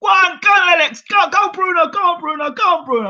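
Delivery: high, loud cheering for Bruno; dad cheering at the TV, high and loud; excited cheering. Retold or imitated. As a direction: egg the fighter on at the top of the voice. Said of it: "And my dad said".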